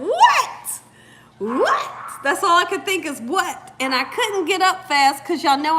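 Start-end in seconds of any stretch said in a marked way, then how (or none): 0.0-3.7 high startled yelps of what; shocked what. Retold or imitated. Seeing a fox behind her, shocked high what. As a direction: caught off guard, squeak it out. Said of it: "And I'm thinking".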